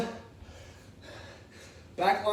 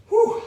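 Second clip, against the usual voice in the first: loud breathy exhale; 11 dB louder than usual. Real, not acted. Exhale after the round.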